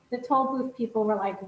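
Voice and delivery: ordinary talk, nothing marked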